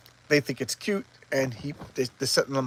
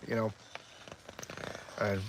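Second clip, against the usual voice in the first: ordinary delivery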